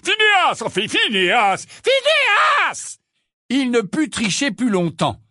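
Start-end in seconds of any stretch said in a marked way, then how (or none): 0.0-3.0 very high, loud cries of a name; shrill-pitched, insistent shouting. A narrator's reading. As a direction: several people yelling to wake him. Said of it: "crier son nom".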